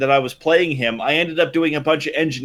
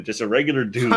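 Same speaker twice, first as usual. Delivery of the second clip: ordinary talk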